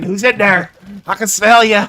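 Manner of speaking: loud, high mock challenge; imitated enemy player. Enemy calling to hidden squad, mock taunting call-out. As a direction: call out like a player hunting someone.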